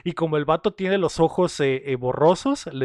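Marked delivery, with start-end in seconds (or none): none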